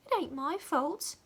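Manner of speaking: put-on high girlish voice; a touch of the little maid; small servant. A narrator's reading. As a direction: defend yourself meekly.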